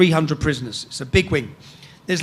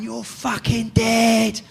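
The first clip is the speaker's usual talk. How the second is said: retold shouting; 3 semitones higher, much slower than usual. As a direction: imitate a shouted threat, held back. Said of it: "they're all shouting".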